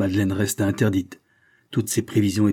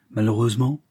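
plain reading voice

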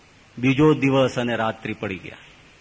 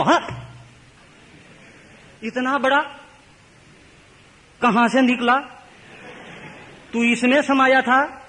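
high, wide-eyed astonishment in Hindi; amazed boy; 12 semitones higher than usual. Acted. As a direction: gasp it out like a child seeing a miracle. Boy amazed at God appearing.

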